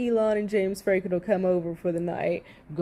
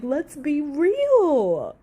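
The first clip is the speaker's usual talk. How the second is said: slow, louder, sarcastic let's be real; drawn-out exasperated demand; 7 semitones higher, 5 dB louder, much slower than usual. Real, not acted. Vlogger fed up with Amber's lies, slow exasperated drawl. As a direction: stretch it out, fed up.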